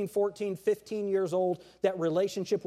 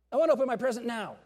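put-on fast, higher whiny kid demanding now; impatient child; 5 semitones higher, 4 dB louder, much faster than usual. Preacher voicing a kid who can't wait, fast and whiny. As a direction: rattle it off like a greedy child.

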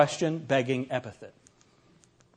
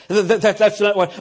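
stuttering, tripping over the first sounds, louder; mock stammer; 4 semitones higher, 6 dB louder than usual. Flustered professor on the phone, stammering in mock. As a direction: stammer as if caught off guard. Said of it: "they start stuttering real bad".

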